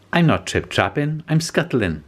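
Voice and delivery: a lightly high, small creature voice; tiny spider voice. Acted.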